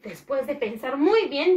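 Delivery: usual speaking voice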